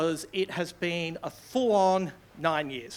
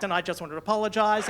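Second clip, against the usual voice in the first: ordinary delivery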